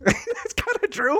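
high, slow, through laughter; laughing concession. Real, not acted. To a co-host after the tire joke, laughing. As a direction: concede it while cracking up.

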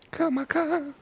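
tiny high-pitched beetle calling out; small insect voice, called up the ship. Acted. Beetle Kibo calls his friend.

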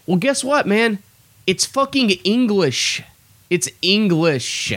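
high-pitched, drawn-out exasperation; incredulous comic outburst at a clueless coworker; exasperated. Retold or imitated. Exasperated punchline to coworker's confusion.

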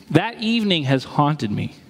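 usual speaking voice

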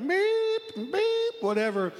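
high mock beeps, tossed off; quick high beep beep, dismissive; reversing truck beeps. Imitating annoying truck beeps.

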